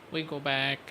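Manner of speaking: normal talking voice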